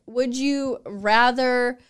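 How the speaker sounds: drawn out slowly, half-teasing; a slow, hammed-up quiz question; slow mock question. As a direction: slowly pose a gross dilemma. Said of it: "you'll be like".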